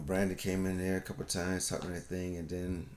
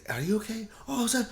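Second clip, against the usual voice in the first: much higher, syrupy sympathy; pitying coo; 14 semitones higher than usual. Retold or imitated. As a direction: keep asking the sad one if they're fine. People pitying a sad person.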